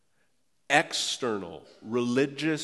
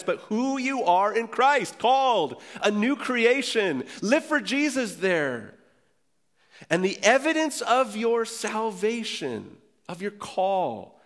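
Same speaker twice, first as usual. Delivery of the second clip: preaching at full force; 8 semitones higher, slower than usual. Acted. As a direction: lift the congregation with a ringing call. Rising to a preaching climax.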